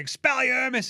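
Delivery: high, loud mock wizard spell; mock spell casting. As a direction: cast it like a schoolboy wizard.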